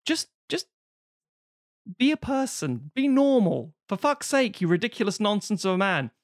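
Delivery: high, pleading exasperation; exasperated. Real, not acted. Podcaster exasperated at the ridiculous hero, pitched high. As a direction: plead with him to act normal. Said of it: "exasperated rant at character".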